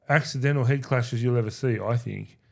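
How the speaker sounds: ordinary talk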